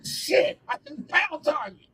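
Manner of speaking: high, fake-disappointed squeal; whiny high voice; mock dismay. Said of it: "I was like".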